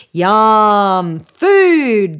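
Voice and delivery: loud, excited shout for food. A narrator's reading. Hungry boys shout about dinner, excited. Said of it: "the boys shouted".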